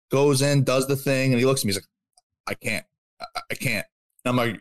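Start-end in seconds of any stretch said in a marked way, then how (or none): 2.5-3.9 soft, halting refusal with a repeated start; imitated stutter. Second gym member refusing to let him in, stuttering. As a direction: imitate a hesitant stammer, low and quiet. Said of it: "this guy has a f-ing stutter".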